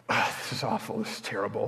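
sighing, low, hushed mutter to oneself; dejected muttering. Retold or imitated. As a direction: imitate a friend sighing and grumbling under his breath. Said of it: "That's what he muttered to himself".

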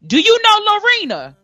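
high-pitched yell, imitating a fan calling out; retold yell. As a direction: holler it like a fan outside the house. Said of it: "yells out".

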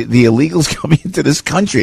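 laughing through the words; a laugh breaking into the phrase. Real, not acted. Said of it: "I'm laughing".

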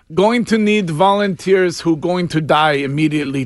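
put-on Russian accent; mock Russian official making an announcement. Authorities calling for doomed volunteers, mock Russian accent. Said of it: "you do the Russian accent".

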